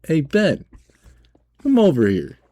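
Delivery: high, loud, snarky holler; snarky yell. Retold or imitated. Cashier yelling for manager to piss her off, snarky. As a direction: shout across with pointed attitude. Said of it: "I yell at him in the snarky voice".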